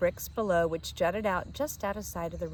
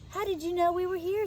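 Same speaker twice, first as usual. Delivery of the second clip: put-on small boy; 11 semitones higher, faster than usual. A narrator's reading. Tom asks Emma.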